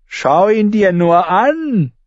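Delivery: high, slow, neighing mockery; whinnying laugh. Lommi jeering at Arya, a whinnying laugh. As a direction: bray out the taunt. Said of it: "wieherte Lommi Grünhand".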